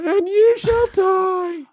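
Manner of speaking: very high, squeaky, drawn-out mock threat; high-pitched helium voice. Shown as a demonstration. Gamer imitating a human intimidating aliens on helium, high-pitched squeaky voice. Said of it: "with a really high-pitched voice".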